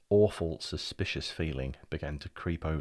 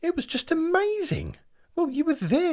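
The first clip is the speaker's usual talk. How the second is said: much higher, louder, thrilled; excited gushing; 7 dB louder than usual. Acted. Brian reliving the outing to his brother, excitedly. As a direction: gush with delight.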